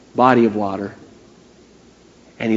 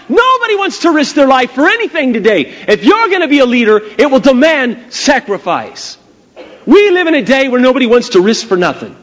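high, insistent sermon exhortation; impassioned preaching; 9 semitones higher, 3 dB louder than usual. Real, not acted. Preacher challenges would-be leaders to sacrifice, preaching passionately. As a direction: press the challenge home with raised pitch.

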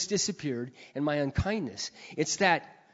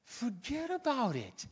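high but soft, drawn-out dismissal; gentle, stretched-out divine reassurance; voicing God; 9 semitones higher, 4 dB quieter, slower than usual. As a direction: slowly wave the worry away. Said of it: "all God says is".

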